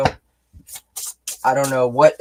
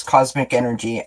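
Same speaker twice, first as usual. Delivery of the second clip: ordinary talk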